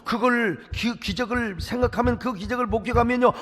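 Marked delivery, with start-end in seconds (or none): none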